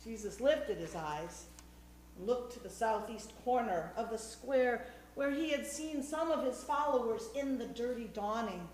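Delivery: usual speaking voice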